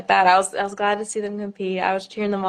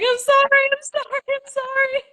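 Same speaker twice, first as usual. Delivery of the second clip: much higher, panicked repeated sorry; frantic apology. Retold or imitated. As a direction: blurt out sorries in a half-awake panic. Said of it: "And I'm like".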